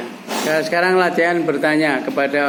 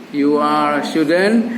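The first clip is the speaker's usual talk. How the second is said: slow, measured sentence for repeating; drawn-out teaching example; slow model phrase; much slower than usual. Shown as a demonstration. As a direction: say it slowly for learners to echo.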